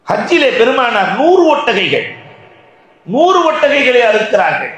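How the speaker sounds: high, ringing sermon delivery; preaching. Real, not acted. Preacher tells congregation of the Prophet's yearly sacrifice, preaching. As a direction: proclaim it in measured phrases with pauses.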